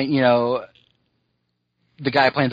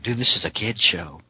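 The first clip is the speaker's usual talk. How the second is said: dismissive listener; 4 semitones lower than usual. Retold or imitated. Voicing listeners who chime in.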